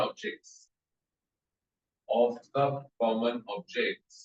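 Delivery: very slow, word-by-word dictation pace. Acted. Teacher dictating a heading to students, slow and measured. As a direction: spell it out slowly so students can write.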